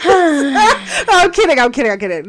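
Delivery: loud laughing backtrack; loud, giggly just-joking. Real, not acted. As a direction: burst out laughing while taking it back. Laughs after teasing with a quote.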